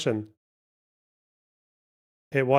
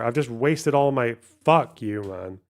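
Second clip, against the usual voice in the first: ordinary talk, nothing marked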